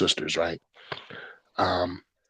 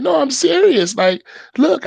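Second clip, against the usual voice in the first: insistent; 3 dB louder than usual. Retold or imitated. Insisting to wife.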